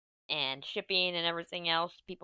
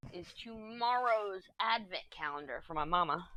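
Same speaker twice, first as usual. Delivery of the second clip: ordinary talk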